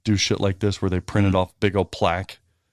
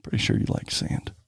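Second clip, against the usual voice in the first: whisper; 8 dB quieter than usual. Acted. Hushed teasing to Dennis.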